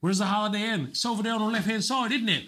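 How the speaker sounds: high, mock British accent with a questioning tag. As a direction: mimic Brits who end on a question. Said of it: "answer everything in a question".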